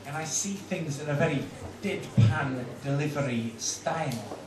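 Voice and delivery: slow, deadpan acerbic comedian; drawn-out, dry stand-up parody; Frankie Boyle. Acted. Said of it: "my impersonation of Mister Frankie Boyle".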